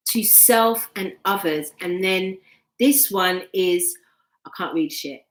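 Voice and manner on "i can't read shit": ordinary talk, nothing marked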